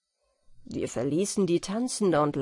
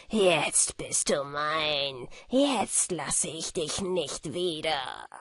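low, slow, panting villain voice; panting monster; 5 semitones lower, 3 dB quieter, slower than usual. Acted. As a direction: leer at her with heavy breath. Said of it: "keuchte er dabei".

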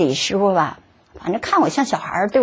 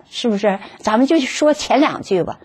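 ordinary talk, nothing marked

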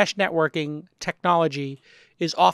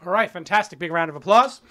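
usual speaking voice

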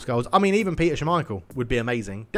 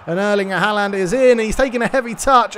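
higher, louder urgent call; excited build-up; 7 semitones higher, 8 dB louder than usual. Acted. Commentator as the striker breaks through, alarmed and excited. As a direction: rise with the danger of the run.

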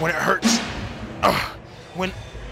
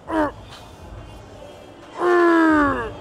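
straining shout; 13 semitones higher than usual. Real, not acted. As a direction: cry out under the weight. Grinding a hard rep.

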